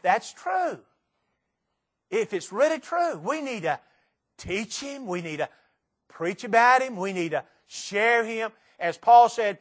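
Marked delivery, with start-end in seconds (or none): none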